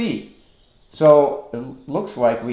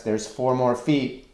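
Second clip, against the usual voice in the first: ordinary delivery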